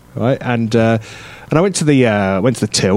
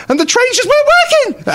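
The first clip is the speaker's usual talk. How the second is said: voice cracking. Retold or imitated. Moaning to friends about the trains, voice cracking high. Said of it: "I sort of went like that".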